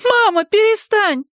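put-on high, loud, slow childlike shout; child's cry. A narrator's reading. Girl crying out to her mother, high loud shout. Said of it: "закричала Мадда".

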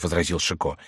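ordinary delivery, nothing marked

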